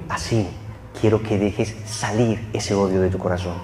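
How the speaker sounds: soft, low, tender inner voice; gentle voice of God. Acted. The Lord speaking to the man's heart, soft and tender. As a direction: speak softly into his heart.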